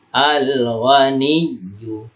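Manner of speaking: slow "Al-Ghaniyyu", l sounded before gh; clearly read lam. Shown as a demonstration. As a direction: recite "Al-Ghaniyyu" drawn out, each letter distinct. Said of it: "Jelas ya".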